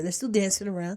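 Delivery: usual speaking voice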